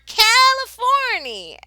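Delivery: very high, loud, drawn-out cheer; joyful shout. A narrator's reading. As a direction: cheer it out slowly with joy. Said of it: "shouted everyone".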